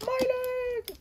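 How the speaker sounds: very high squealing wail, over and over; pained cry. Acted. Injured toy fighter wailing about his leg, high mock shriek. Hurt character crying out.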